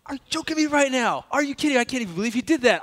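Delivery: high, incredulous outrage; are you kidding me, fast and high; mock-shocked viewer; indignant disbelief. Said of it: "I'm getting all indignant inside".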